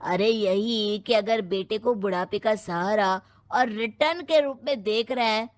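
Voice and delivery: ordinary delivery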